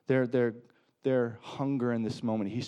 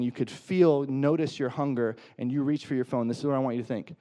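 ordinary talk

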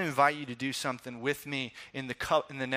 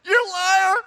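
much higher and louder mock accusation; mock outraged shout; 12 dB louder than usual. Preacher voicing his own reaction to the researcher, a hammed-up outraged shout. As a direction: shout it at the lying adult, hammed-up.

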